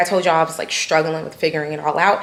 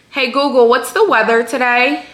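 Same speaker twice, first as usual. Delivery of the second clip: raised voice command to smart speaker; talking to voice assistant; 5 semitones higher, 5 dB louder than usual. Real, not acted. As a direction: call out clearly to the assistant. Calling out to voice assistant.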